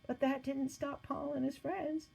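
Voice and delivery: usual speaking voice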